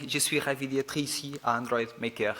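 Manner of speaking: Portuguese speaker's careful French accent; French with a Portuguese accent, trying hard; foreign accent in French. Real, not acted. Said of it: "I'm going to try to speak French again".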